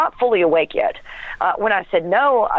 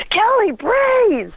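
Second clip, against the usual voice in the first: frantic retold yell, much higher and slower; panicked yell; much slower than usual. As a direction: yell it out like a terrified husband. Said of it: "he's yelling".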